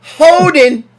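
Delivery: "Holding" called out high and loud like the referee; imitating referee. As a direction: bark "Holding" the way the ref announces it. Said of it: "That's how he says it".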